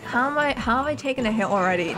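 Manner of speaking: ordinary talk, nothing marked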